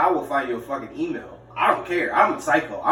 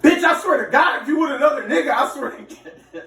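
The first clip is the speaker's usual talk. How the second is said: high, loud outburst; mock furious threat; 10 semitones higher, 5 dB louder than usual. Boyfriend raging on his girlfriend's voicemail, angry shout. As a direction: shout it in a jealous rage, starting high.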